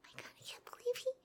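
fast, giddy whispering to herself; excited whisper. Acted. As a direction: whisper it fast, bursting with glee. Gushing to herself after leaving.

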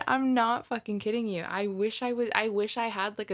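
ordinary talk

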